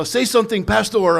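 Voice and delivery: high mock Filipino accent. Inmates asking the pastor to speak, imitated Filipino accent. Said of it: "That's my Filipino accent".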